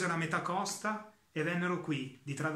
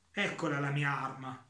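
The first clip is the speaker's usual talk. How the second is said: ordinary delivery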